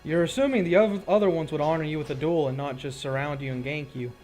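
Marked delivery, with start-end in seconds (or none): none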